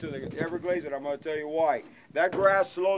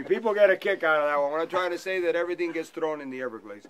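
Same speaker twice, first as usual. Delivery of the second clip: usual speaking voice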